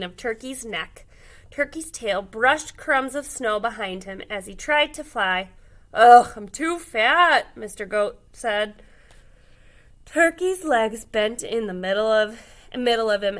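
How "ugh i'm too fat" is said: loud, slow groaning complaint; groaning fat turkey. A narrator's reading. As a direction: grunt it out, too heavy to fly. Turkey can't fly.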